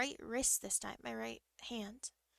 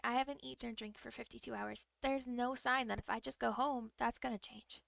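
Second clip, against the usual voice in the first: normal talking voice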